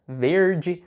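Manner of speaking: "verde" with a drawn-out Paraná R; Paraná accent with retroflex R. Shown as a demonstration. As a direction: pull the R in "verde" like a Paraná local. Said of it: "esse R bem mais puxado".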